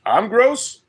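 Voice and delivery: indignant high-pitched question; surprised. Real, not acted. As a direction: act offended, pitch jumping up. Mock offended at a comment.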